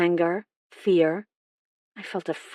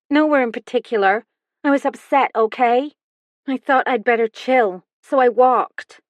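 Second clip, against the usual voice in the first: higher, louder, brushing off the questions; a sulky girl voiced higher and louder; defensive teen daughter; 6 semitones higher, 5 dB louder than usual. A narrator's reading. Daughter answering the detective, defensive and higher-pitched.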